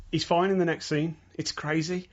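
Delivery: ordinary delivery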